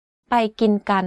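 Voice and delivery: every word of "ไปกินกัน" at constant pitch; middle tone on "ไปกินกัน". Shown as a demonstration. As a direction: say "ไปกินกัน" with no stress on any pitch. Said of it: "all of the words are in middle tone".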